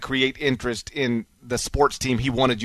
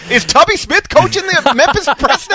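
incredulous; 13 semitones higher, 9 dB louder than usual. Real, not acted. Host mocking co-host's guess.

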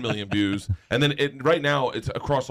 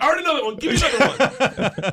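high, eager show-off; giddy, high-pitched impatience; excited; 11 semitones higher, 4 dB louder than usual. Acted. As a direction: beg for the next challenge, laughing. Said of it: "behind the bar excited".

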